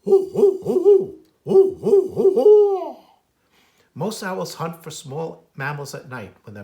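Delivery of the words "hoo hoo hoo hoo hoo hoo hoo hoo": high, loud hooting owl calls; ringing owl hoo-hoo ending in a laugh; loud owl hoots. Acted. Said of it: "what sounds really like".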